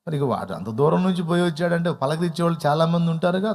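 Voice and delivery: ordinary delivery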